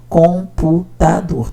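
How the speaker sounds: "Computador" spoken very slowly, syllable by syllable. Shown as a demonstration. Teacher counting syllables on a child's page, sounding out slowly. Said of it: "Tem quatro sílabas".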